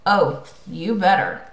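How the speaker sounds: plain reading voice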